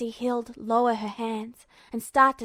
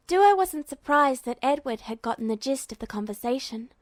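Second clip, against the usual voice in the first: ordinary delivery, nothing marked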